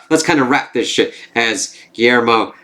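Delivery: usual speaking voice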